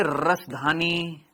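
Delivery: slow, stretched-out term; lingering on each syllable; Prime Minister-like tone. Real, not acted. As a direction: draw it out like an orator. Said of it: "अभी मेरी बोलने की tone पता है किसके जैसी".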